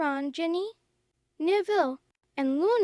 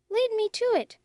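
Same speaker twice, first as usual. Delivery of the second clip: ordering; 6 semitones higher, 3 dB louder, faster than usual. Acted. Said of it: "she ordered".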